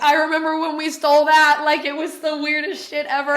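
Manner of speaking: a thought replayed with giddy wonder; amused disbelief. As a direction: marvel at the irony. Retells thought while dusting stolen item.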